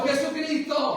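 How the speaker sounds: loud, high shout of Jesucristo; shouted name. Shown as a demonstration. Pastor shows the congregation how to shout Jesucristo. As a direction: shout it out for them. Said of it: "Grítelo".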